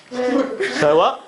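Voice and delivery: high-pitched startled mimicry; startled squirrel. Squirrel stops eating as if someone called, startled. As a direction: imitate a jolted, suddenly alert squirrel. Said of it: "as if someone's calling him".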